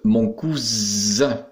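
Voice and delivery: "cousin" slowly, with a long buzzing Z. Shown as a demonstration. Teacher demonstrating the rule, "cousin" with drawn-out Z. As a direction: stretch the Z sound in "cousin". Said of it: "se dit ze".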